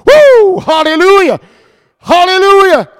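loud, high praise shouts; exultant shout. Real, not acted. Preacher rousing congregation after the hush, exultant shout. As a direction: whoop and shout praise full out.

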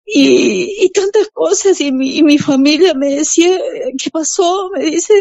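high, choked-up testimony; tearful joy. Real, not acted. Telling how her family welcomed her conversion, tearful joy. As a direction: voice rising as real tears come.

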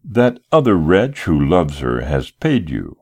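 plain reading voice

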